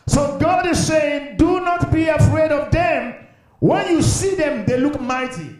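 high, ringing preacher delivery; impassioned preaching. Real, not acted. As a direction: preach it with mounting insistence. Preaches at raised pitch.

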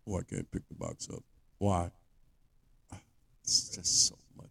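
low, soft, feeble excuse; mock helpless weakling. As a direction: give up faintly, acting too weak to cope. Mocked weakling refusing to lift.

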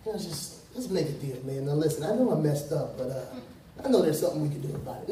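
lightly acted offhand bargaining with a king; casual deal-making. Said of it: "You're not walking in there upright like".

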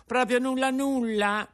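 high, loud dismayed cry; can't believe there's nothing left; incredulous puppet. Acted. Pinocchio asking Geppetto in dismay, high loud incredulous cry.

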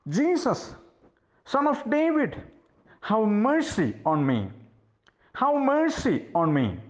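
high-pitched, mildly shouted plea; shouting plea. Acted. Blind beggar calling to Jesus over the crowd, shouting plea. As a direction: call out high, dropping at each phrase end. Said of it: "he started shouting with a loud noise".

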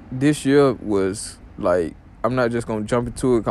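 normal talking voice

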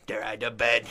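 loud, put-on caretaker voice; Filch impression. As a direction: imitate the cranky night watchman. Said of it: "he'd be like".

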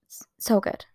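breathless whispered It's so good. Real, not acted. Telling viewers about the new album, whispering. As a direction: whisper it in awe.

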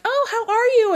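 high, loud mock delight; gushing greeting. Two old friends meet in the aisle, gushing. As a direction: squeal hello like a long-lost friend.

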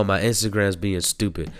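normal talking voice

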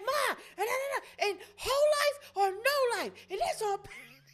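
put-on high, sassy teen voice; sassy teenage girl. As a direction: stammer then snap the catchphrase. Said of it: "She be like".

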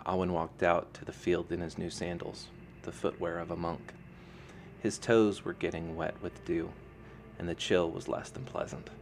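plain reading voice

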